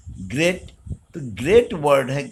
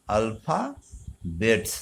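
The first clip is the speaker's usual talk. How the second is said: ALPHA, pause, BETS, slowly; word split in two; 3 semitones lower, much slower than usual. Shown as a demonstration. Teacher dictating to children as they write, Alphabets split slowly. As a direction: say Alphabets in two slow, separated parts.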